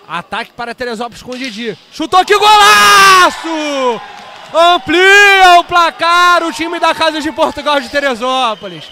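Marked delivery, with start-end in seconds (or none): 1.9-8.8 high, loud, slow goal yell; explosive goal commentary. Real, not acted. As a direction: stretch out the goal call. Goal scored, commentator erupts.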